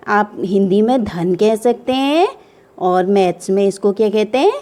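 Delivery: ordinary delivery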